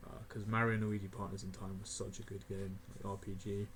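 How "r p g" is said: ordinary talk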